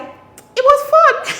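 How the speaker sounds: high, loud squeal into laughter; laughing. Real, not acted. Telling viewers about being a teenager, laughing. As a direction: stretch it out slowly through giggles.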